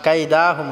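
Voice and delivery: কাইদাহুম with the দা drawn out; learner's error, long দা in কাইদাহুম; wrongly stretched vowel. Shown as a demonstration. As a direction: drag the middle a of কাইদাহুম. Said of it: "হবে না".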